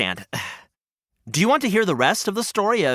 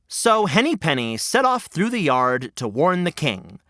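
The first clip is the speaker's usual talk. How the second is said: normal talking voice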